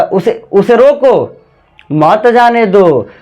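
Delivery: slow "उसे रोको", gap, drawn-out "मत जाने दो"; pause after रोको. Shown as a demonstration. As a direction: break clearly between रोको and मत. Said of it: "यहाँ कॉमा लगाया था".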